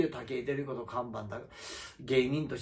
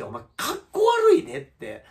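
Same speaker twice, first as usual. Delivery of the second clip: higher, louder, mocking jab; higher, louder mock put-down; scornful retort; 7 dB louder than usual. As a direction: sneer at him with raised voice. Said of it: "って俺思って".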